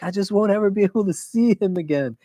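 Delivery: put-on a small boy mildly sad about losing his friend; sad little son. Sleepy son telling his papa he'll miss his friend, childlike voice.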